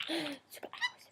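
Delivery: slow gasped whisper; awed whisper. Real, not acted. Owner to the bird about mummy's owl top, slow whisper. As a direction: breathe it out in wonder.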